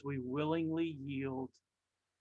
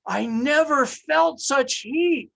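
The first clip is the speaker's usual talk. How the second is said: higher, louder, slow; anguished cry; 8 semitones higher, 10 dB louder, slower than usual. Acted. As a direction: cry out from the heat. Teacup in the oven.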